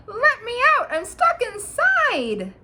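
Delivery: very high, loud tiny voice, slowing on stuck inside; high-pitched panicked plea; shrill cry. A narrator's reading. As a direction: squeal it out like a trapped little boy. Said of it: "he cried out in a shrill voice".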